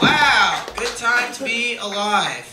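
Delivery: drawn-out, loud gabagool; mock Italian-American. Comic opening with a hammed-up Italian-American gabagool. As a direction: stretch gabagool like a Jersey mobster.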